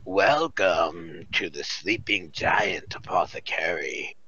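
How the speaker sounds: low, slow, breathy welcome; husky smoker whisper. Acted. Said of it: "She says".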